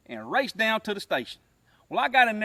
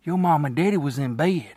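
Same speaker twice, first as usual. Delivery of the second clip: ordinary talk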